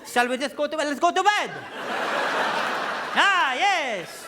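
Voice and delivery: high, loud, gleeful outburst; over-the-top thrilled yelling; excited. Acted. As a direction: shout it high with mounting excitement. Acted excited plea for bed.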